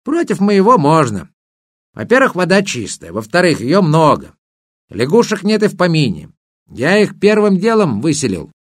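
a hint of a chatty river spirit; water spirit voice. Water spirit praises his lake.

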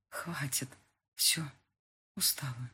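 soft, spent whisper; exhausted whisper. A narrator's reading. Heroine alone after being locked in, exhausted whisper. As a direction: let it fall out, worn out.

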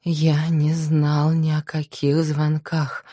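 ordinary delivery, nothing marked

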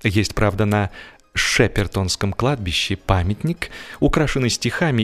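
ordinary talk

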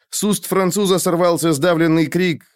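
ordinary delivery, nothing marked

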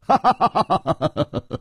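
short high falling laugh; hearty laugh that drops off; knowing laugh. A narrator's reading. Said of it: "楚留香大笑".

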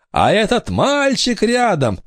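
high, slow accusing intoning; ghostly pronouncement. A narrator's reading. Ghost girl points out brother, high intoning voice. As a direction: present him like a haunting spirit.

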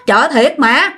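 acted-out emphatic denial; insistent. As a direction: insist you're telling the truth. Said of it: "nhỏ này nó khẳng định".

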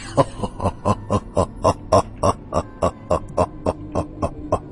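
long mocking demon laugh; low evil laugh, drawn out in pulses; creature's laughter. A narrator's reading. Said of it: "سمعت صوت ضحكات". (ضحكات شريرة طويلة)

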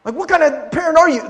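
high, loud mock scolding; a judgmental churchgoer's mock rebuke. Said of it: "people not being like".

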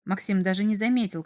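plain reading voice

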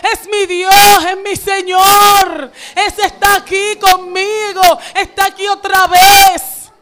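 slow, loud, high, near tears; overjoyed shout. Retold or imitated. Disciple seeing his beloved master again, tearful shout. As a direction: cry out each name with joy. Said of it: "pudo haber dicho".

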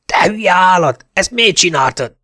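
high, loud furious yell; angry yell. Acted. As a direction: scream it at your idiot partner. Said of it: "üvöltötte a társa". (dühös üvöltés)

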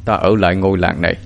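lightly voiced old man, low-key; a hint of an aged teacher; old master. A narrator's reading. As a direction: answer the boy with quiet, measured authority. Old master answers his young disciple.

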